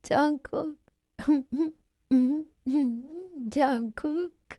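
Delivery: acted weepy plea; crying. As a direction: call his name through sobs. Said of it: "रो क्यों रही हो तुम".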